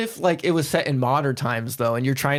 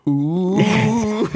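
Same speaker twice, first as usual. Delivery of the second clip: spooky ghost ooh; 7 semitones higher, 3 dB louder than usual. Retold or imitated. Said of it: "it just goes".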